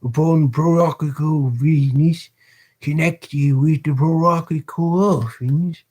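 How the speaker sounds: hammed-up British accent for a pompous beadle. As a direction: puff yourself up like a self-important parish beadle. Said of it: "the extremely annoying accents".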